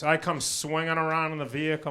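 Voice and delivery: ordinary delivery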